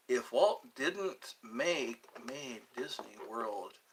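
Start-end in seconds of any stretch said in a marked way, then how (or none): none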